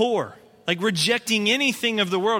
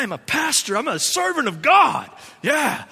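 puffed-up boast, building louder and higher; mock self-importance; 7 semitones higher, 6 dB louder than usual. Pastor mocking his own pride after a good meeting, swelling boast. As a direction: proclaim it like a man full of himself. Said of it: "start to get this whole like".

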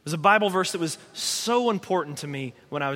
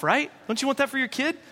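ordinary delivery

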